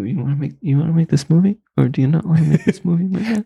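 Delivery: high pleading voice building; high-pitched begging imitation; mock desperate pleading. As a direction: beg him to say yes. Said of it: "I'm like".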